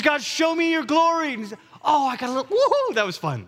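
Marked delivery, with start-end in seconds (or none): 0.0-1.3 high-pitched, fervent begging cry; eager plea. Acted. Moses pleading with God to show his glory, eager plea. 1.8-3.5 high-pitched mock whoop of delight; playful thrill. Moses after getting goosebumps from God, playfully thrilled.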